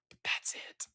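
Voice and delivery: under-the-breath whisper. Real, not acted. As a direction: drop to a whisper to close. Trailing off under his breath.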